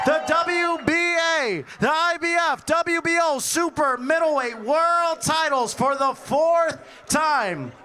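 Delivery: booming fight-night ring announcer. Acted. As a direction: hype up the crowd, building. Boxing ring introduction.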